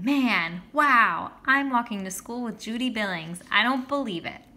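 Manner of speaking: put-on a boy who can't believe his luck; amazed inner thought. Narrator's dazzled inner thought.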